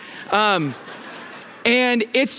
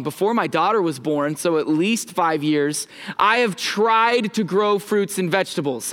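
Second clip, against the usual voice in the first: ordinary talk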